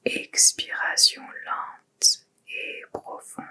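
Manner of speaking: slow, soft whisper; whispered breath cue. Real, not acted. Yoga teacher cueing a slow exhale, whispered. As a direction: whisper it slowly, like a long exhale.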